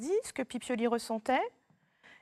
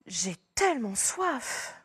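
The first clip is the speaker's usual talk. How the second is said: slow, drawn-out complaint; weary thirst; slower than usual. Shown as a demonstration. Someone declaring real thirst, slow and weary. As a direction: drag it out as if parched. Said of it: "qu'il a vraiment soif".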